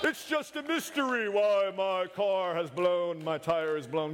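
high, slow dramatic wail at first; theatrical, drawn-out complaint; mock lament to God. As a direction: cry out to heaven in hammed-up bewilderment. Acts people wailing at God.

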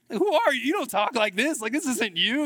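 high, loud mock disbelief; incredulous reaction. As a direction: imitate being baffled by mom's sudden new talk. Acting out his reaction to mom's talk.

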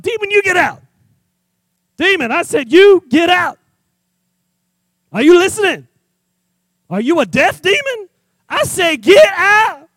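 high, loud mock exorcist commands; a disciple ordering a demon out; shouting at a demon. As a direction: yell the demon out, ham it up.

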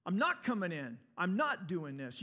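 put-on loud, defiant kid digging in; stubborn angry outburst; angry refusal. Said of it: "You see this kid be angry".

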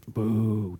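low, soft drawn-out boo; quietly boo the villain; mock boo. Mock booing of Judas.

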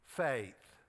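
"faith" dropped low and set apart after a pause; stress on faith. Shown as a demonstration. Preacher underlines "faith" for the congregation, "faith" set apart low. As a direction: lean on "faith" as the underlined word. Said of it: "underline that word".

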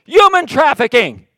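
loud, high, slow exasperated shout; furious preacher voicing Paul; annoyed outburst. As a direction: bellow it like a man fed up. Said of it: "being greatly annoyed".

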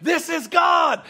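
God punched hard and drawn out; exclaiming. Retold or imitated. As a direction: proclaim it like a revelation. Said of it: "I'm like".

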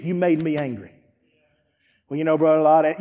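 usual speaking voice

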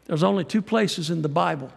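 usual speaking voice

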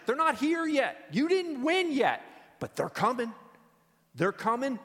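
ringing cry of hope; fervent rising preach. Real, not acted. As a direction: preach it with mounting fire. Preacher building to climax.

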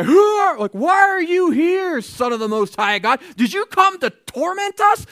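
high, slow, loud screechy demon voice; shrieking demons. Acted. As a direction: wail it like a cornered demon pleading. Demons begging Jesus, acted voice.

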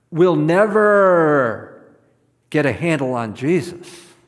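slow, loud, drawn-out "never"; stress on never. Real, not acted. Preacher to congregation, slow loud "never". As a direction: hammer the word "never" home. Preacher punching key line.

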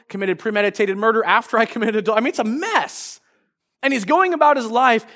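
ordinary delivery

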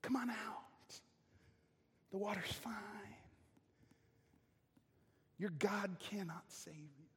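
soft, hushed coaxing; serpent's whisper of a lie; whispered temptation. Acted. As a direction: lure them out in a whisper.

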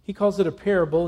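ordinary delivery

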